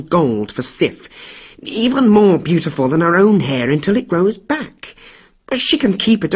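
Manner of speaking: smooth-talk the furious god down; cajoling. Acted. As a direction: trickster sweet-talking Thor to save his skin. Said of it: "cajole and try to appease".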